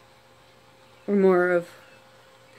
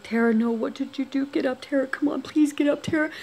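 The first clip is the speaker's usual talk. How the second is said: retold plea, rising higher in pitch; higher-pitched, worried coaxing in a retelling; urgent pleading; 6 semitones higher, 5 dB quieter than usual. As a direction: imitate someone urging a friend to wake. Retold voices begging her to get up.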